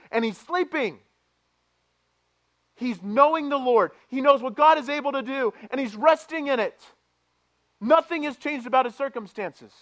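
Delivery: loud, high, fervent sermon voice; full-force preaching. Acted. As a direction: preach it out to the church.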